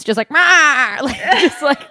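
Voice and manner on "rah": loud cartoon yowl; high, wild mock shriek; cat lady screech. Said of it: "become the cat lady from The Simpsons".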